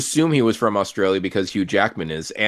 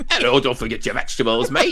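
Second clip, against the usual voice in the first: mock Australian; 3 semitones higher than usual. Mocking cartoon Wolverine's voice.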